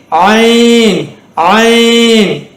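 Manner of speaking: ஐன் drawn out from the throat; slow Arabic 'Ayn' for ஐன்; throat sound. Shown as a demonstration. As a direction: squeeze the throat and hold ஐன் long. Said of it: "தொண்டையில் வரக்கூடிய உச்சரிப்பு".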